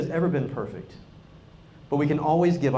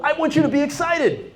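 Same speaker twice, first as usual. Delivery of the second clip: excited; 9 semitones higher than usual. Shown as a demonstration. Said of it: "my pitch goes up".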